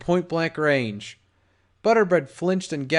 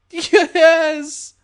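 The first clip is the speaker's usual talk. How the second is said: high, loud, panicked cry; terrified cry; 13 semitones higher, 8 dB louder than usual. A narrator's reading. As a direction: cry out in terror. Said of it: "Butterbread cried".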